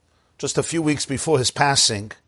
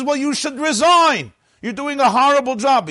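very high, indignant outburst; furious father; 13 semitones higher, 4 dB louder than usual. Acted. Furious father telling off the principal, high-pitched outrage. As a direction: tell him off in a high, outraged voice. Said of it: "the father is furious".

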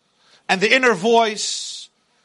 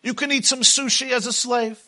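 booming motivational speech; 4 semitones higher than usual. Acted. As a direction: preach it as the taunting tyrant. Pharaoh voice held, preaching.

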